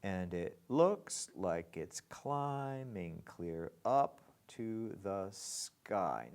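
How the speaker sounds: slow reading, stress on looks and up; slow scansion of the line; marked accents. Shown as a demonstration. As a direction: draw out each stressed beat. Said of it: "let's mark the accents".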